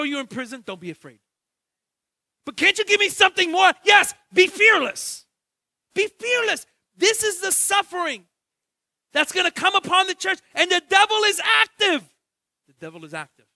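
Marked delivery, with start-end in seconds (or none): 2.4-10.4 loud, high preacher's call; full-force preaching. Acted. 10.5-12.0 loud declaration. Acted. Repeated line, loud then hushed. 12.7-13.5 hushed repeat. Acted. Repeated line, loud then hushed.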